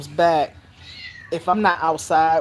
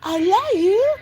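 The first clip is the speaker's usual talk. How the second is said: put-on high, louder child voice; affectionate little girl; 3 dB louder than usual. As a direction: declare love like a delighted small child. Child hugs her, retold.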